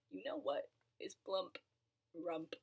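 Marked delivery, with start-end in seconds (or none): none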